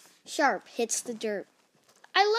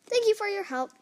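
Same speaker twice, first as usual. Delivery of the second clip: grateful Piggy; 9 semitones higher, 3 dB louder, faster than usual. Acted. Piggy thanks her helpers.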